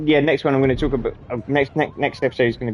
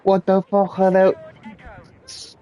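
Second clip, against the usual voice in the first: very slow, much higher mock outrage; shocked sergeant; 9 semitones higher, much slower than usual. Sergeant inspecting recruit's feet, mock outrage. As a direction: drag it out, hammed-up disgust. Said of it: "and he goes".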